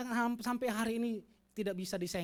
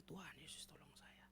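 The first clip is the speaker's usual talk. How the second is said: faint unvoiced whisper to Jesus; whispered prayer; 3 semitones lower, 20 dB quieter than usual. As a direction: plead under the breath. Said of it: "Tapi dalam hati ini".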